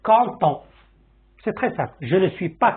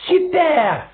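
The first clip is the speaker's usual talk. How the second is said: high and louder burst of joy; excited; 11 semitones higher, 4 dB louder than usual. Shown as a demonstration. Teacher showing another way to express joy, excited. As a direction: cheer it like you just won. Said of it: "Ou bien".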